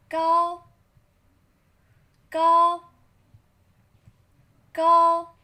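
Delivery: 高 held high, level and loud; loud, high flat 高 for the class to repeat; first tone 高. Shown as a demonstration. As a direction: call out 高 high and steady. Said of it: "Read after me".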